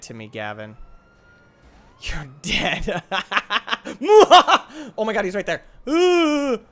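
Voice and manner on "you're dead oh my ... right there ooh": high, loud laughing taunts; cackling. Real, not acted. Gamer gloating as a rival closes in. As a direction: laugh and jeer in a squeal.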